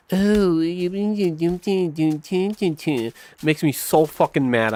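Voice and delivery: sing-song mock of a patronizing poster; mocking playful voice. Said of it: "like, playful".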